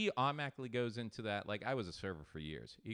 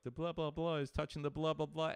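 higher, singled-out blah-blah mimicry; kid's mock complaint; 6 semitones higher, 4 dB louder than usual. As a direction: parody the child's fussy routine. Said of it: "Aiden does another one of his".

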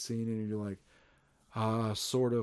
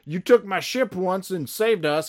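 higher, louder, hammed-up furious rant; mock angry Jada; 5 semitones higher, 5 dB louder than usual. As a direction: spit it out like a bitter old rival. Voicing Jada angrily.

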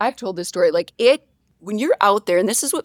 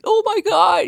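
high mock exclamation; mocking others' teasing; 9 semitones higher than usual. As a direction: imitate their teasing in a raised, high voice. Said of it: "everyone's making fun of me".

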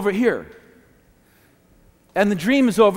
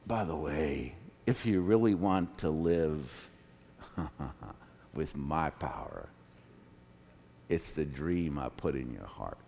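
God speaking intimately; 13 dB quieter than usual. Acted. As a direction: confide it softly from behind the veil. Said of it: "pull away the veil and say to you".

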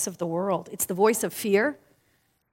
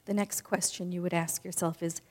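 guided visualization; 2 semitones lower, 3 dB quieter than usual. Acted. Speaker guiding the audience through closing their eyes, softly.

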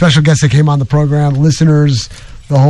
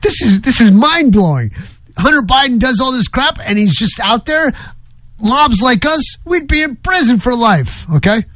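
higher-pitched incredulous mobster; 7 semitones higher than usual. Retold or imitated. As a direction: act out an astonished mob guy, pitch climbing. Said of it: "he's talking like this".

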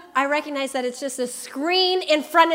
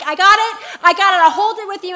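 eager helper; 5 semitones higher, 7 dB louder than usual. Retold or imitated. Eager offer to hold bags.

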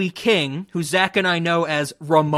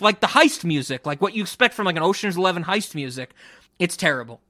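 ordinary talk

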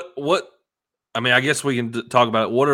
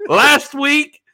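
high, hammed-up wailing fan lament; mock distraught fans; 13 semitones higher, 5 dB louder than usual. Fan mocking his own team in a high distraught wail. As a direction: whine it like a heartbroken fan. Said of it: "and they're like".

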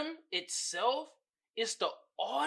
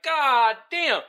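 "God damn" said out loud, louder; 5 semitones higher, 10 dB louder than usual. Retold or imitated. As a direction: exclaim "God damn" in disbelief. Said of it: "I'll be like".

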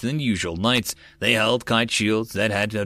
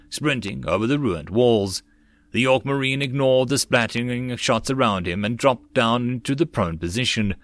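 plain reading voice

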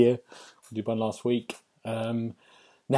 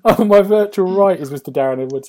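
higher and louder, booming host introduction; mock presenter voice; 7 semitones higher, 9 dB louder than usual. Host presenting his second guest, in a booming mock presenter voice. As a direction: announce him like a grand game show host. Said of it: "Do you like my new presenting skills?".

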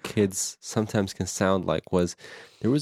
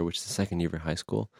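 normal talking voice

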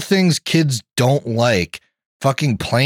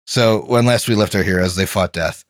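game master narration; 8 semitones lower than usual. Acted. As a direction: set the scene like a storyteller. Game master sets the scene.

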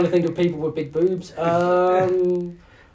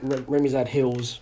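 normal talking voice

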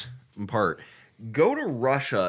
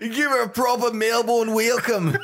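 high, loud, slow Aussie hype; mock Australian; 13 semitones higher, 5 dB louder than usual. Joining the bit, loud high hammed-up Australian welcome. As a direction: yell it up high like a silly Melbourne host.

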